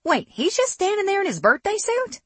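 put-on high Southern-accented disbelief; incredulous Southern US accent, pitched very high; thick Southern accent. A narrator's reading. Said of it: "as a thick southern accent rolled off her tongue".

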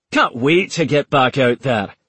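sarcastic Scottish soldier; Scottish accent. Acted. McLaughlin answers his officer with dry Scottish sarcasm. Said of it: "in a thick Scottish accent".

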